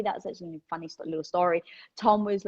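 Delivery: normal talking voice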